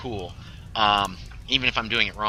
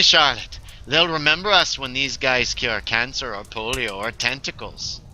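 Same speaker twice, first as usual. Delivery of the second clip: raised, emphatic character voice, slowing; proud game character; 3 semitones higher, 3 dB louder than usual. Acted. Character persuading Charlotte about making history, proud voice. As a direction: pitch the grand mission with conviction.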